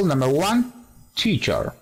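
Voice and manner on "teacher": "teacher" stressed on TEA; first-syllable stress. Shown as a demonstration. Teacher modelling word stress, "teacher" accented on first syllable. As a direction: lean on the first syllable of "teacher", let the second drop. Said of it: "Acento, primera sílaba".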